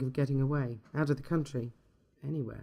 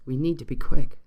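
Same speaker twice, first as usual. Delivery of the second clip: louder, urgent Irish lilt; urgent Irish accent; 11 dB louder than usual. A narrator's reading. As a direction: push him to hurry, Irish accent. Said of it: "said the Irish voice".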